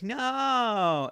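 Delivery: high, loud mock no; sharp mock cry; mock shout. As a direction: yell it like a bystander. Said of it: "Someone else is like".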